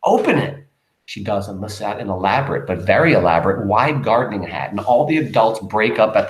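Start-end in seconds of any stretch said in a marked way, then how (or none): 0.0-0.7 proud. Acted. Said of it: "very proud".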